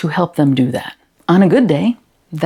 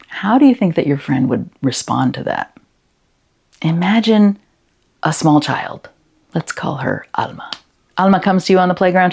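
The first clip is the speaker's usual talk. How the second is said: ordinary talk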